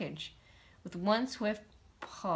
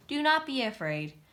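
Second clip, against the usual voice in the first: reassuring showman; 6 semitones higher, 5 dB louder than usual. A narrator's reading. Reassuring crowd.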